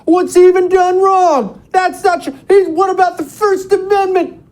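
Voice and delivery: very high, loud mimicry of indignant complainers; put-on high voice, shouting in protest; mock outraged objectors. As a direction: squeal the objections in mocking, high-pitched outrage. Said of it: "you know what I got a ton of".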